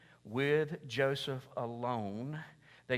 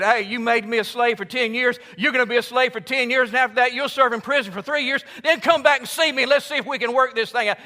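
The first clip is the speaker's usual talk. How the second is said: loud, high, mocking sentence; vengeful Joseph; 11 semitones higher, 15 dB louder than usual. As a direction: hand down payback with relish. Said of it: "You'd have said".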